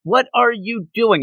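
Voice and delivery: high-pitched flustered mock shout; upset, bewildered. She reacts to his Pilates guess, high-pitched upset outburst. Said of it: "She's, like, so upset about this".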